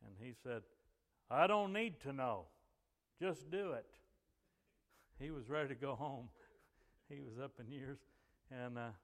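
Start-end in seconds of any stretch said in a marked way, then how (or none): 1.3-3.9 loud, raised commanding voice; booming voice. Retold or imitated. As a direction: imitate a preacher's booming order. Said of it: "he had a booming voice".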